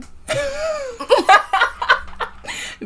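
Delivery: high burst of laughter. Real, not acted. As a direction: laugh high at your own joke. Laughs after sarcastic remark.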